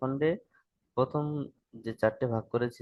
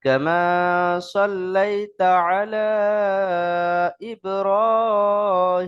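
slow, drawn-out chanting, higher and louder; Quranic-style recitation; 7 semitones higher, 7 dB louder, much slower than usual. Shown as a demonstration. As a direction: recite it for learners, stretching the long vowels. (ধীরে টেনে সুরেলা তিলাওয়াত)

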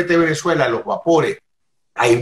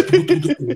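imitated racing heartbeat; quick repeated "tu" for a thumping heart; pounding heartbeat noise. Storyteller mimics his frightened friend's heart, pounding heartbeat noise. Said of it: "el corazón le hace".

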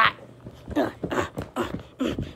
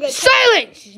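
loud, higher shout of silence; mock command; 9 semitones higher, 6 dB louder than usual. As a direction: bark it out like a cartoon villain. Commanding friend mid-video.

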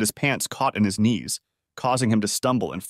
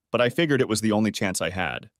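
ordinary delivery

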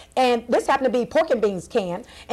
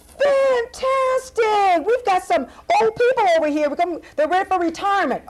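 excited clown host; 8 semitones higher than usual. Acted. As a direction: tease the kids with clownish delight. Clown host jokes about guests.

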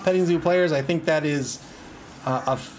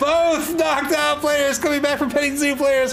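laughing through it; 8 semitones higher than usual. Real, not acted. Laughing at comeback news.

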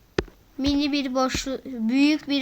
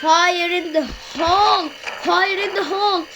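callout shout; 4 semitones higher, 6 dB louder than usual. Acted.

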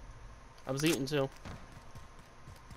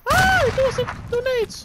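high, loud alarmed cry; startled yelp; 14 semitones higher, 7 dB louder, faster than usual. Real, not acted. Player yelping at a teammate as grenades land, high and loud. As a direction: caught off guard by a grenade, yelp it out.

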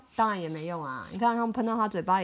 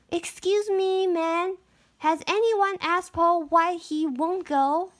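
put-on little girl voice; 7 semitones higher than usual. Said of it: "Marigold speaks to mayor".

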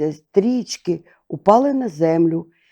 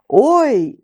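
crowd cry; 7 semitones higher, 3 dB louder than usual. A narrator's reading. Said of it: "закричали люди".

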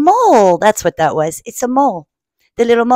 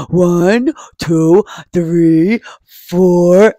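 Bear counting; 3 semitones lower, 4 dB louder, much slower than usual. Acted. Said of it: "Bear says".

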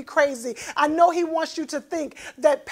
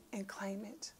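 whispered aside; 4 semitones lower, 20 dB quieter than usual. Real, not acted. Confiding aside to the congregation.

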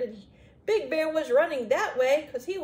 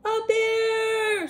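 high, loud storybook scream; drawn-out frightened cry; 10 semitones higher, 9 dB louder than usual. A narrator's reading. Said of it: "screamed Eddie".

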